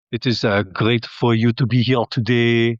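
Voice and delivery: a hint of American accent; mock American accent. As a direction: greet the audience in a fake American voice. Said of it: "try and be like".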